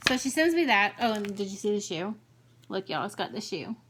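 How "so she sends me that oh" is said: ordinary talk, nothing marked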